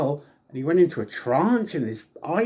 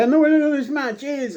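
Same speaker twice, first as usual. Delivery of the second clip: much higher announcer mock voice; mock ring announcer, pitched way up; imitating Howard Finkel; 13 semitones higher, 3 dB louder than usual. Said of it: "Howard Finkel was about to say".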